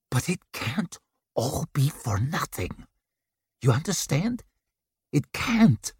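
forced through gritted teeth; pressing words through a clenched jaw. Acted. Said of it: "force the words through gritted teeth".

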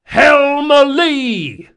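high, drawn-out bellow at full force; yelled order. A narrator's reading. Yelling a sailing command to the helm, loud long shout. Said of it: "then yelled".

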